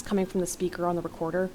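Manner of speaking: ordinary delivery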